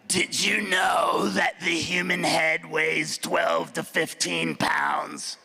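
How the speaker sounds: low, slow smoker's drawl; mock chain-smoking masseuse. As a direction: rasp it out like a three-pack-a-day smoker. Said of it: "she sounded like she smoked".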